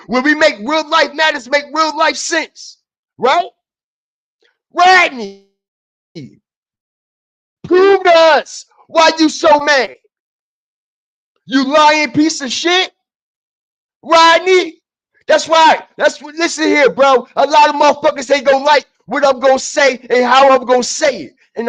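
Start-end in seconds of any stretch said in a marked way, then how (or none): 4.7-15.8 angry callout shouted at Rodney. Real, not acted. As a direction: yell at him to prove it. Calling out Rodney angrily.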